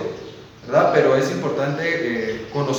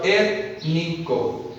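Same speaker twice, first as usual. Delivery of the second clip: "étnico" very slowly, split into syllables; syllable-split demo; 4 dB quieter, much slower than usual. Shown as a demonstration. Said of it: "Si yo lo separo". ("étnico" muy lento, sílaba por sílaba)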